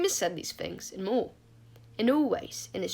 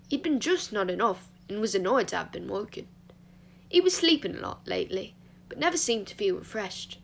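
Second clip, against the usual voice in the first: ordinary delivery, nothing marked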